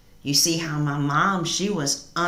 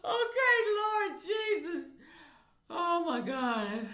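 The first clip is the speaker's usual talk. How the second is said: recovering from laughter; 12 semitones higher, 5 dB quieter than usual. Real, not acted. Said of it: "She is funny as hell".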